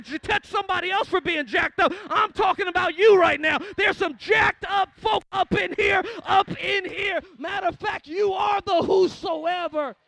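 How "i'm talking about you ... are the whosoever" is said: preaching shout. Real, not acted. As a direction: drive it home to the crowd. Preacher building to shouted climax.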